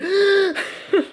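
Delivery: big gasp. Retold or imitated. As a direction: heave in a breath. Collapsed after running, breathless.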